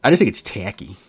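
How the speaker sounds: tacky said in a Midwestern twang; Midwestern accent. Pretend. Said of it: "I put on my Midwestern accent for that".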